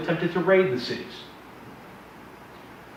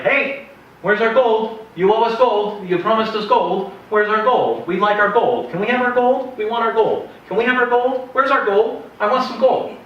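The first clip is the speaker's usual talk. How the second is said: demanding; 9 semitones higher, 6 dB louder than usual. Retold or imitated. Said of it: "Mitanni are like".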